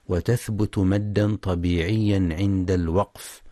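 ordinary talk, nothing marked

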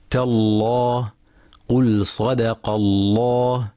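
slow recitation, thick dark L in "الله"; heavy lam. Shown as a demonstration. Teacher showing the name of God after fatha, heavy lam. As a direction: make the L of "تالله" full and heavy, drawn out. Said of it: "واتفق القراء على تغليظ لام لفظ الجلالة".

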